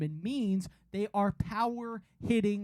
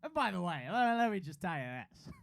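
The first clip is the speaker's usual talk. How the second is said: put-on slurred, sloppy drunk guy; 3 semitones lower than usual. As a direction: talk like you've had a few too many. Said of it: "that was my drunk voice".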